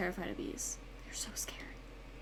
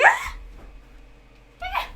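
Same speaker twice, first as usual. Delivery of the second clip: two piercing high shrieks, much louder; retold screams; 5 dB louder than usual. She runs from a bee, shrieking high and loud. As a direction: shriek twice in panic. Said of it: "I'm just like".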